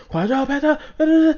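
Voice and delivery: imitating a hyped player bouncing around at a pentakill; mock hype gamer. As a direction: bounce off the walls with it. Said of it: "the person that's like".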